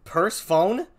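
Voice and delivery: purse-phone as two words, rising in disbelief; Persephone as purse-phone. Retold or imitated. Streamer repeats someone's mistake, incredulous purse-phone with a rise. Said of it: "Someone said".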